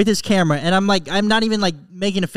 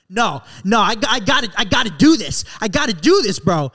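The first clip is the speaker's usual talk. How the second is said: mock insistence, higher and punched louder; Austin; 3 semitones higher, 4 dB louder than usual. Friend imitates Austin refusing his gym's calls to come back, mock insistence. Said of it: "Austin's like".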